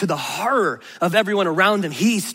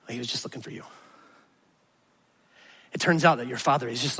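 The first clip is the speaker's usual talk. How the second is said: softer, lower, intimate preaching; tender sermon close; 8 semitones lower, 5 dB quieter than usual. Real, not acted. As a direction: speak close and gently to each listener.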